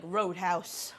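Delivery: lightly voiced cartoon dad quote; a hint of a Family Guy character. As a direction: toss off the catchphrase in a mild impression. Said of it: "Family Guy fans ... I just be like".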